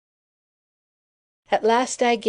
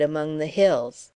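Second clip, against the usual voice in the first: usual speaking voice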